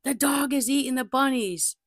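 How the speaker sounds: imitating the aunt screaming up the stairs, high and loud; aunt yelling about the dog, retold as a high loud scream; retold frantic scream. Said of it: "crazily screaming".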